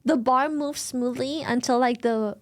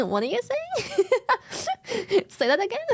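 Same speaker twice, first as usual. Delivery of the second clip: laughing confusion; 6 semitones higher, faster than usual. Retold or imitated. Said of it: "William was like".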